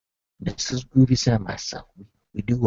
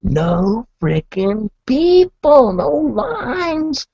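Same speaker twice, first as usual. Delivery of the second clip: loud, slow and high exclamation; hammed-up exasperated astonishment; mock disbelief; 12 semitones higher, 6 dB louder, much slower than usual. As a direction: stretch it out loudly, climbing in pitch. Mock outrage at empty store.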